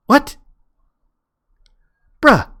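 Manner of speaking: usual speaking voice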